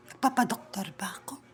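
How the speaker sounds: hushed whispered question; low conspiratorial whisper. Real, not acted. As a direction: whisper it as a teasing aside. Teasing aside to kids.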